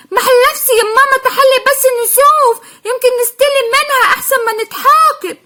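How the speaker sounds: put-on high, quick little-kid voices; child voices. Two little sisters panicking about forgotten school paints, childish voice. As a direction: play the worried small children.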